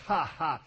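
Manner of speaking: short spoken "ha, ha" at own slip; real quick laugh at a blunder; self-amused laugh. Real, not acted. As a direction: laugh off your mistake out loud.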